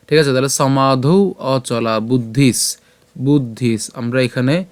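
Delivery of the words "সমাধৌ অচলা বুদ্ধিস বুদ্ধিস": "সমাধৌ অচলা বুদ্ধিস" slowly, ending bud-dhis; careful Sanskrit pronunciation. Shown as a demonstration. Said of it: "ধিস পর্যন্ত উচ্চারণ করছি".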